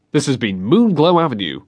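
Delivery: plain reading voice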